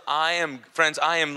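ordinary talk

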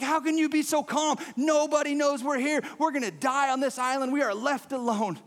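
hammed-up desperate protest; freaking out. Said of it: "starts freaking out".

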